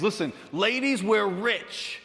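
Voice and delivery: high, thrilled proclamation; excited announcement. Acted. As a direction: announce a windfall to your friends. Hag announces her lucky find.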